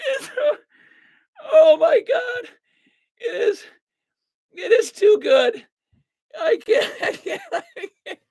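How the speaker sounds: high, broken phrases between real laughs; helpless laughter. Real, not acted. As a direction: give up talking as laughter takes over. Overcome by laughter at the blunder.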